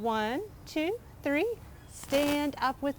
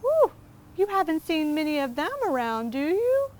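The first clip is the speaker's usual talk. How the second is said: much higher, louder, pinched little frog voice; tiny-mouthed frog; 6 semitones higher, 5 dB louder than usual. Acted. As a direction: squeeze it out through a tiny mouth. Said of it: "made his mouth as small as possible".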